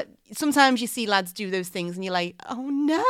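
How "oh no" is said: pitched-up mock dismay; an appalled little "oh no", acted out; cringing onlooker; dismayed reaction. Voicing onlooker's horrified reaction.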